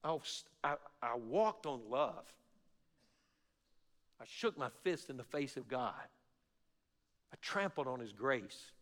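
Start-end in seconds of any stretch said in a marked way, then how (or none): none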